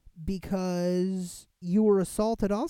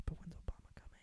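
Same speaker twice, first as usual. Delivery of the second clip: whispered impatience; 13 dB quieter, much faster than usual. Retold or imitated. Waiting hungry for Obama.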